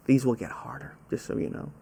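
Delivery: breathy whispered warning to players; conspiratorial whisper to the quiz players; whispered aside. Real, not acted. Quiz host's teasing aside.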